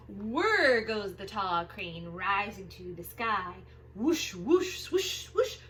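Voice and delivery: lively picture-book read-aloud; reading to children. Acted. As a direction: read it big and bouncy for little kids.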